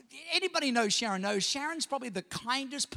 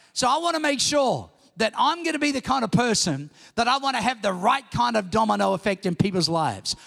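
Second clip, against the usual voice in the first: louder, building preacher's call; rally the congregation with conviction; preaching; 6 dB louder than usual. Acted. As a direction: pastor urging his church to guard their words. Preaching at full force.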